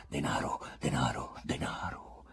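fading, hushed whisper of the crowd; buzzing whisper. A narrator's reading. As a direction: murmur it like a rumour spreading. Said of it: "Dovunque ronzava la parola".

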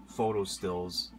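ordinary talk

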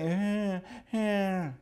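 long high whining; dog whine. Retold or imitated. Storyteller imitating Fluffy answering his owner, whining. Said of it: "he whined like he was answering back".

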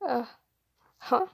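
ordinary delivery, nothing marked

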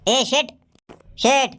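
high calling for the landlord; shouting for the boss. Acted.

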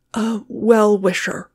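slow, weighty, far-off voice. A narrator's reading. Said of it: "with a trifle more emphasis".

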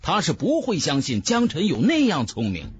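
ordinary delivery, nothing marked

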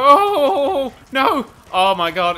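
loud, high alarmed cry; dismayed cry. Real, not acted. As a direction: react to sudden disaster. Crash in race.

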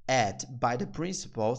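very slow, split phrase; slow demo. Shown as a demonstration. Teacher explaining the line to students, slow. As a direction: lay it out slowly. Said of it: "Então".

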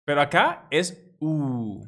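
"U" lenta y alargada; U larga. Shown as a demonstration. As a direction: estira la "U" despacio. ("U" larga y lenta)

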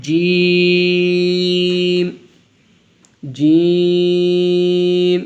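"জিম" with a firm hard j and long held ee; hard, firm sound. Shown as a demonstration. Teacher leading repeats of the letter, firm long "জিম". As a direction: hit the j of "জিম" hard, stretch it out. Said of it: "শক্ত ও কঠিন".

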